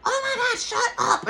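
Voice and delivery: very high squeal of shocked delight; high-pitched mock scream; Tanya. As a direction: imitate a surprised, excited screamer. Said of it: "she screams".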